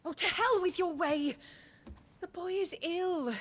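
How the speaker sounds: put-on she refuses to let him take the boy, and it bursts out of her; angry outburst. Wife protests taking sick son.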